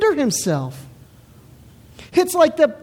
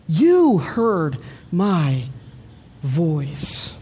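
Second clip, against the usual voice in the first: "You" drawn out, then each word slow and low; slow, weighty reading that leans on "You"; stress on You; 8 semitones lower, much slower than usual. Shown as a demonstration. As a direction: set "You" apart and let "voice" drop low. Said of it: "notice the shift".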